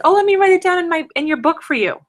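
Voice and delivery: high, quick chirpy imitation of a brand rep; mock sweet offer. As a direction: chirp it like an overly helpful rep. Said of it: "they just said".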